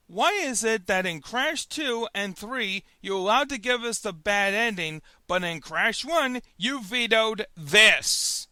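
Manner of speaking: normal talking voice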